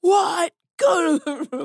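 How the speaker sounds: high-pitched mock yell of indignation; outraged teacher. Imitating a teacher who overheard cussing, mock outraged. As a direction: shoot the pitch way up, scandalized and scolding.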